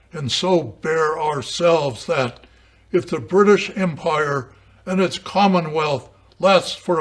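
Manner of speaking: loud, slow, weighty oratory; declaimed wartime speech. Acted. Lecturer quotes Churchill addressing the House of Commons, loud declaimed oratory. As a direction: hammer out each stressed word, slowing toward the close.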